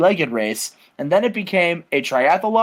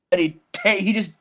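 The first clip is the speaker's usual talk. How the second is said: normal talking voice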